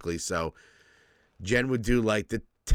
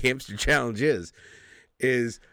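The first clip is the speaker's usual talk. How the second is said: ordinary talk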